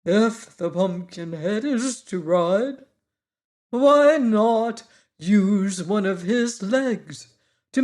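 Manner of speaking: proud Wogglebug showing off his coat; pompous Wogglebug. A narrator's reading. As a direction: speak like a self-important bug. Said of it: "looked the party over carefully".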